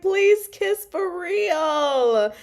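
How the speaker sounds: high, loud, drawn-out plea; fangirl begging. Retold or imitated. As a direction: beg them, stretching it out dramatically. Fan begging characters to kiss.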